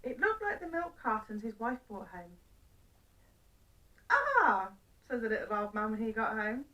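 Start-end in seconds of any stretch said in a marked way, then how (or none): 4.1-4.7 pleased exclamation. Acted. Old man pleased to be home.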